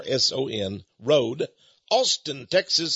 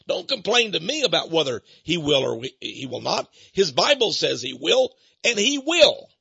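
insistent preacher, rising high on the final assertion; emphatic preaching; 5 semitones higher than usual. Real, not acted. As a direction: drive it home like a sermon's verdict.

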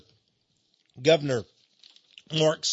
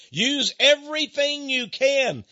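loud, slow emphatic push; 10 semitones higher, 6 dB louder, slower than usual. Real, not acted. Rallying listeners.

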